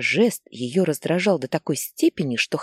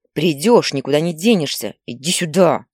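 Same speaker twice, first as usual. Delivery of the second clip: louder, insistent threat; angry shout; 5 dB louder than usual. Acted. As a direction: shout him down. Mother shouting after fleeing son. (мать громко кричит вслед сыну)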